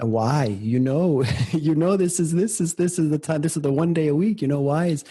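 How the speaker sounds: mock inner complaint. Meditator replaying his grumble at the cleaning noise, mock complaint. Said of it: "this little drama began to unfold".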